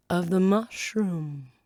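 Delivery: slow caterpillar voice; a slow caterpillar answer. Acted. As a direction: answer slowly.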